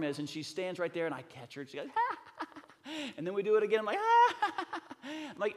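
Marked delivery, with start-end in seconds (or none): 1.9-3.1 high-pitched mock giggle; imitated nervous laugh. Daughter's reaction to being caught. 4.0-4.8 loud, high mock laugh; imitated excited laugh. Father's reaction to repeated trust fall.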